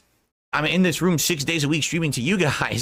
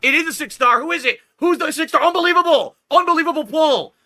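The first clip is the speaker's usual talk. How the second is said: high, loud, rising hype; excited pull reaction; 13 semitones higher, 5 dB louder than usual. Real, not acted. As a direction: shout the lucky pull in disbelief. Pack opening excitement.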